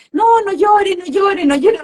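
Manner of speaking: high, hurried mock shushing of a child; imitating a parent who wants the crying over; mock hushing. Parent hushing a crying child, high mock shushing.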